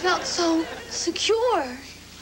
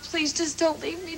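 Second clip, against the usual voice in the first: normal talking voice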